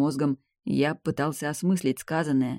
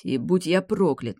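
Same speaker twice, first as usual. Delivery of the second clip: ordinary delivery, nothing marked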